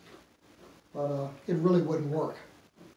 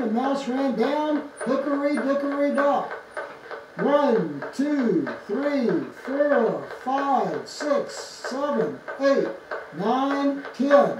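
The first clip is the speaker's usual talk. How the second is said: tinny phonograph playback, high and loud; nursery rhyme counted out through the horn; gramophone; 7 semitones higher, 6 dB louder, much slower than usual. Real, not acted.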